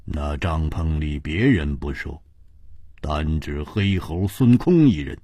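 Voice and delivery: low, measured, coldly mocking; cold sneer. A narrator's reading. Ji Bingyan cautions the man about spying on the tent, cold sneer. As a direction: coldly warn him off in a low voice. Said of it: "冷笑着说".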